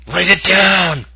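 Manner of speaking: loud, high mock-bombastic yell; emphatic shout. Host sealing a first place prediction, bombastic shout. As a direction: bellow it like a grand proclamation.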